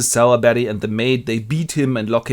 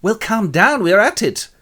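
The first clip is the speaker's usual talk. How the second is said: higher-pitched fussy reply; defensive placating; 9 semitones higher, 4 dB louder than usual. Acted. Crook placates his demanding client, high and defensive. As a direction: fend off the boss, voice pitched up.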